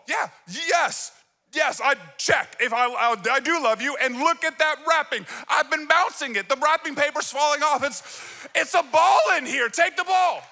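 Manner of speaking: exasperated dad. Retold or imitated. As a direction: roep het uit als een vader die er klaar mee is.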